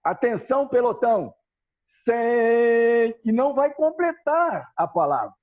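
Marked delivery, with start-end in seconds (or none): 0.0-3.2 commanding drill call, cut short; voz de comando. Shown as a demonstration. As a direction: bark the command like a drill leader. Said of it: "ele vai falar o seguinte pra vocês". (voz de comando militar, cortada no "sem")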